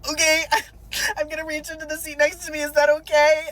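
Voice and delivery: high, loud blubbering; mock crying. Crying driver warns cop before reaching, mock sobbing. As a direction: ask permission through hammed-up sobs. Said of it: "so I'm crying".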